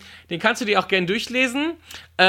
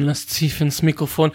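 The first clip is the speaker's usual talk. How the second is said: normal talking voice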